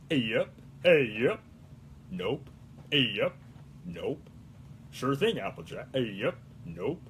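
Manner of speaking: very low, terse country farmhand; deep, soft southern one-word answers; Big Mac. Acted. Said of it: "Big Mac".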